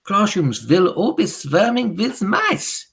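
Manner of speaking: high, loud, slow witch proclamation; shouting witch, drawn out; Grand High Witch. A narrator's reading. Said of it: "shouted the Grand High Witch".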